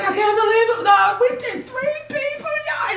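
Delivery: high, loud mock-crying squeal; hammed-up tearful excitement; imitated happy crying. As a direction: imitate someone overcome, wailing in disbelief. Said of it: "she was crying".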